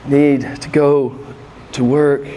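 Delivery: NEED TO GO, slow and loud, then a pause before TO WORK; weighty repetition. Real, not acted. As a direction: drive home "Need to go ... to work" in slow, low, loud chunks. Preacher repeats his admonition for weight.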